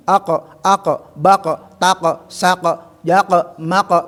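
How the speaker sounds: "ako, bako, tako" each ending in a bouncing qaf; qaf syllable drill. Shown as a demonstration. (latihan suku kata dengan qaf memantul)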